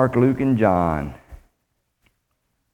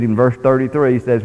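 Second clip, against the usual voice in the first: ordinary talk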